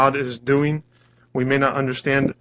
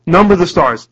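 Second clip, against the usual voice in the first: "Number" punched high and loud; emphatic command; 4 semitones higher, 11 dB louder than usual. Retold or imitated. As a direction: issue a challenge, hitting "Number" hard. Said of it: "that's the way he says it".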